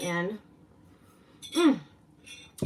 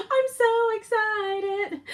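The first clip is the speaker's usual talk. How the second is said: high, slow squeal of excitement; drawn-out delighted squeal; squealing excited; 4 dB louder, much slower than usual. Real, not acted.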